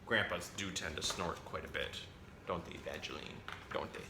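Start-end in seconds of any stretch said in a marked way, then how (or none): none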